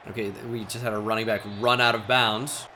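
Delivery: ordinary talk, nothing marked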